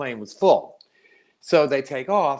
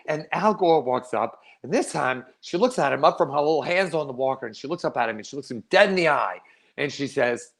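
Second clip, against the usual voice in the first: ordinary talk, nothing marked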